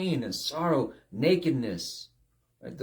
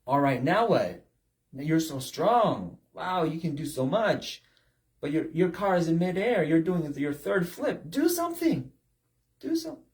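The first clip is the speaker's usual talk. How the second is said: rising, louder mock challenge; God taunting; 4 semitones higher, 4 dB louder than usual. Preacher voices God taunting a proud man mid-crash. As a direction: dare the proud man to act. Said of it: "it's God saying".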